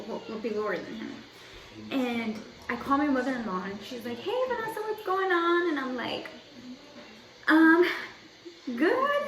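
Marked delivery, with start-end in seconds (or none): 4.2-5.7 high, singsong phone hello; imitated cheery greeting. Mother-in-law answering the phone, imitated cheery high greeting. 7.5-9.2 loud "um", then high squeaked answer; stall, then answer in a raised, uneasy pitch; awkward reply. Retold or imitated. Daughter stalling before telling mother-in-law the news, re-enacted awkward squeak.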